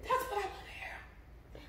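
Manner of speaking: hushed whisper. Real, not acted. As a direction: whisper it softly. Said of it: "get in my inside voice".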